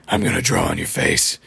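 very low, rasping evil doll; creepy doll voice. Retold or imitated. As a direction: menace them in a deep, creepy croak. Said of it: "in a very creepy voice".

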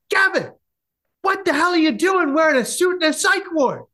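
high-pitched, incredulous outburst; freaking-out father. Retold or imitated. Father spots his son in a suit on the psych ward, freaking out. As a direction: blurt it out as a shocked dad doing a double take. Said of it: "he's just freaking out".

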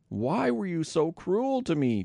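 slow, high, lightly acted moan; a hurt father reproaching his sons, half moaned. A narrator's reading. Jacob reproaching his sons, mild slow moan. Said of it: "Jacob moaned".

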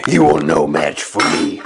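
deep, grave villain threat; deep Darth Maul voice. Pretend. Copying the mask's line.

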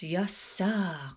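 usual speaking voice

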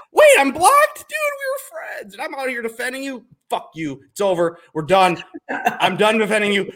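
starts very high and shrill then drops flat and cold; mock outrage. Retelling being blocked by his golf idol, mock betrayed rant. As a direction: play the betrayed fan, then slam the door. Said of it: "so now I'm mad".

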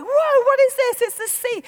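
loud, high mock wonder; imitated amazement. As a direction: exclaim as if seeing the sea first time. Voices amazed reaction to sea.

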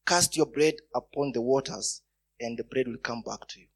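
usual speaking voice

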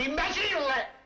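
high, drawn-out cry of imbecille; exasperated insult. Acted. Barber fuming about the apprentice, high exasperated cry.

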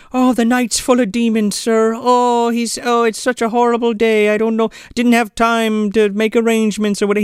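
mock fretting housekeeper. Housekeeper frets to Duncan about the dark night, high and wailing. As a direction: lament like a fluttery maid. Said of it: "she's like".

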